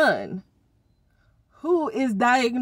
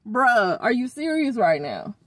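ordinary delivery

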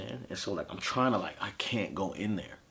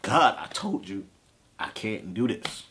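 louder, slow anguished cry to God; breaking down; 4 semitones higher, 5 dB louder, slower than usual. Retold or imitated. Brother breaking down in the room, crying out slowly to God. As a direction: drag the plea out as if breaking down. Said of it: "breaking down again 'cause I'm like".

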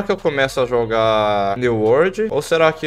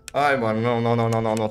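ordinary delivery

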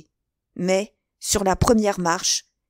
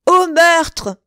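very high, loud yell; yelled alarm; 10 semitones higher, 9 dB louder than usual. A narrator's reading. Patrick sees the stabbing, yelling the alarm. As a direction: raise the alarm at the top of the voice. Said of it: "hurla Patrick".

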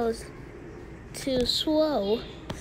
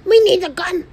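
loud excited panic; 7 semitones higher, 5 dB louder than usual. Real, not acted. Panic in game.

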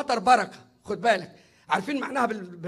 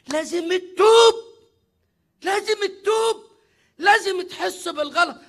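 higher, louder insistence on تتوب; urgent exhortation; 6 semitones higher, 5 dB louder than usual. Real, not acted. Preacher urging his listeners to repent, raised and high. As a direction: drive the call to repent home.

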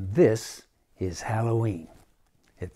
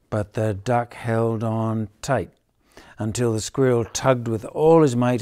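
ordinary delivery, nothing marked

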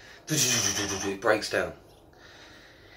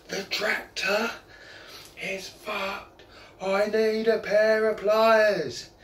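slow, high call that gets louder on pliers; bellowing from the tractor; 10 semitones higher, 3 dB louder, much slower than usual. Retold or imitated. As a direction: haul it out long and loud at the end. Farmer bellowing from tractor top.

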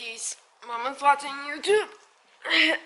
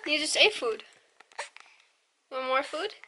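usual speaking voice

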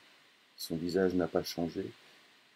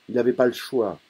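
louder, higher father insisting; father insists, raised voice; forceful; 4 semitones higher, 8 dB louder than usual. Acted. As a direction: lean hard on it, raised. Father pressing his son.

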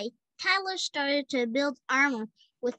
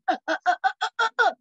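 high, loud stuttering cries; rising crazed yelps; yelling uh uh uh, rising higher; crazy yelling uh uh uh; 7 semitones higher, 7 dB louder, much faster than usual. A narrator's reading. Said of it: "He yelled".

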